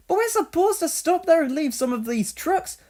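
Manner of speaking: high, alarmed protest; objecting. Acted. Donald protesting to his driver, high alarmed objection. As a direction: push back at the driver. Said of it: "objected Donald".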